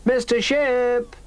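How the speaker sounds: high, slow drawn-out call; girl calling from the yard, slow. A narrator's reading. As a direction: stretch the name as you call. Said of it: "And Rosie called too".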